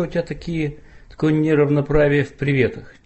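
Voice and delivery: usual speaking voice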